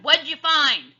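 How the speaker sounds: high, loud shout up to the treehouse. A narrator's reading. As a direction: call it up eagerly. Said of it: "Captain Bones shouted".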